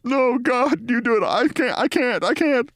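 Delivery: high, pleading "I can't" in mock despair; begging off, hands over face; Bill Maher refusing to tell, high and anguished; mumbling refusal. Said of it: "shakes his body no and mumbles".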